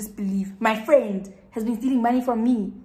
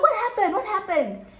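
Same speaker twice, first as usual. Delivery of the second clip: surprised asking; 11 semitones higher, 4 dB louder than usual. Retold or imitated. Friends ask laughing Bully.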